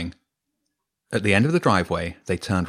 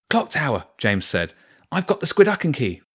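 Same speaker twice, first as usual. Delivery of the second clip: urgent call; 6 semitones higher than usual. Acted. Urgent call while running away.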